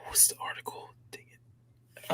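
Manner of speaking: muttered whisper to self. Real, not acted. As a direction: whisper it under your breath. Mutters to himself while searching.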